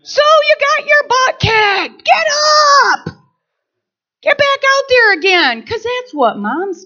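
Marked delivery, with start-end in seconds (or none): 0.0-5.6 high, loud urging; pep-talk shout. Retold or imitated. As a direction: push him to get back up. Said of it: "I'm like".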